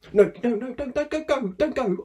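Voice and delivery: high, loud, frantic begging; panicked pleading. Acted. Worker begs boss on the phone not to go, high panic. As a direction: beg him not to hang up.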